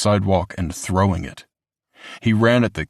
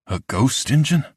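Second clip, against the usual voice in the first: deflated surprise; 6 semitones higher than usual. A narrator's reading. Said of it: "The triumph was gone from his voice".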